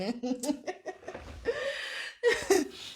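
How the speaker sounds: high, soft giggle; long high-pitched laugh trailing off; real laughter. Real, not acted. Laughs at her own remark.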